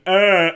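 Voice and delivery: loud short grunt; mock grunt. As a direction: bark a quick uh. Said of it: "someone going".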